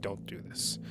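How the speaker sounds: soft whispered please don't; hushed pleading to the boy. Acted. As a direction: breathe it out quietly to him.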